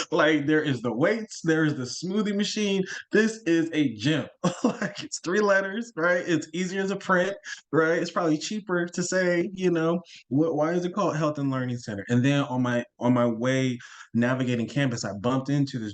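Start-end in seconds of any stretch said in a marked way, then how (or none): 0.1-12.0 higher, laughing, pointing out the obvious; amused incredulous rant. Real, not acted. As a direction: tease it like it's plainly a gym. Ranting about the gym name.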